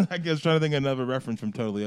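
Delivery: ordinary delivery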